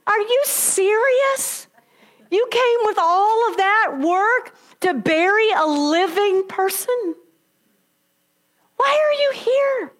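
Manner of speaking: high, slow, disbelieving angel; incredulous angel. Acted. Angels question the women at the empty tomb, high and incredulous. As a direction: angel can't believe they came.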